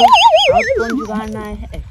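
loud, drawn-out exasperated question. Acted. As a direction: demand it, fed up.

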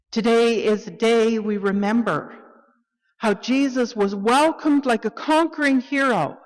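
ringing joy for the congregation; triumphant proclamation. Acted. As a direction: lift it like a welcome for a hero. Call to worship, joyful remembrance.

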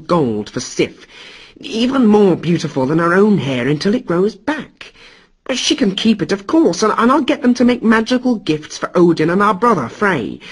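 high, quick coaxing; cajoling Loki. Acted. Loki cajoles the furious Thunder God. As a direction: soothe and wheedle him. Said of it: "Loki began to cajole and try to appease".

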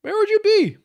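high, loud mocking repeat. Real, not acted. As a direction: push the question up high. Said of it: "repeated question".

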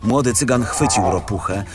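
usual speaking voice

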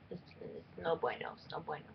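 whispered, under the breath; muttered aside. Acted. Muttering to herself about sarcasm. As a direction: mutter it to yourself.

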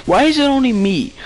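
high, loud whining protest; whiny complaint. Real, not acted. Gamer whining at opponents, high and loud. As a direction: wail at the other players.

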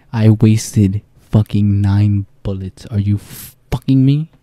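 loud, slow exasperated complaint; slow exasperated yell about wasted bullets; frustrated. Real, not acted. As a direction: drag it out in disbelief.

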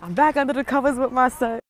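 usual speaking voice

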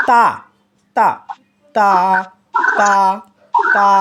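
তা light and thin, not heavy; a crisp light তা. Shown as a demonstration. Teacher repeating the thin-voiced তা. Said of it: "পাতলা আওয়াজে তিনটা অক্ষর".

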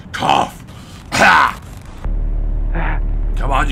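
ordinary delivery

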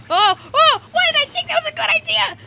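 loud, high-pitched yelling; high squawk of regret; exasperated shout. Real, not acted. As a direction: yell in dismay at your own blunder. Shouts after failed move.

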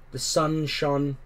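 ordinary delivery, nothing marked

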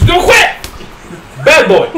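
laughing scold. Acted.

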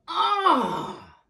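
high cry as he falls; yelp of falling. Acted. Charlie Brown lands hard again with a high yelp. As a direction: yelp on landing.

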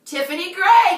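excited announcement. Real, not acted. Announcing a winner.

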